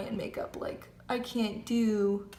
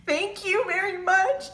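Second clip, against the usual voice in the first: high, slow, choked-up thanks; holding back tears; 10 semitones higher, 6 dB louder than usual. Retold or imitated. Thanking the tow guy for waiving the fee, near tears. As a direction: squeeze the words out, fighting tears. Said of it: "trying not to cry".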